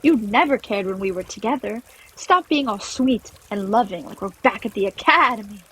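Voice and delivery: incredulous, high start turning accusing; agitated, hurt reproach; upset outburst. Acted. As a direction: confront an ex, fed up. Clover's outburst at her ex.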